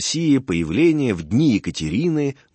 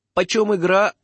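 lightly voiced merchant; 9 semitones higher than usual. Merchant asks the price.

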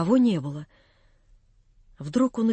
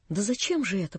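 much softer, almost a whisper; 3 semitones lower, 8 dB quieter than usual. A narrator's reading. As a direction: barely breathe the question. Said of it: "прошептала Наташа".

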